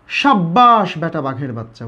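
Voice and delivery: slow "সাব্বাস" with a held double b; emphatic doubled consonant. Shown as a demonstration. Teacher cheering on a lad as an example, emphatic "সাব্বাস". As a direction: lean on the bb of "সাব্বাস", drawing it out. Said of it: "জোর দেওয়ার জন্য কী বলি".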